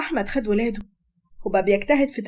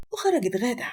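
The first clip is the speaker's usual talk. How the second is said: ordinary delivery, nothing marked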